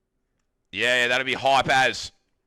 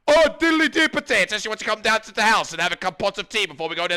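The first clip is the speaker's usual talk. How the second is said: loud, high, rapid-fire Scottish caricature; mock Scottish accent; 6 semitones higher, 5 dB louder, faster than usual. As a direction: rattle it off in a hammed-up Scottish accent. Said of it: "allowed to pretend to be Scottish".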